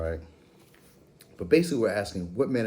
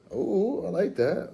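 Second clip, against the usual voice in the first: much higher, delighted ooh; 11 semitones higher, slower than usual. Real, not acted. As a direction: squeal with delight at it. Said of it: "All right, ooh, I like that".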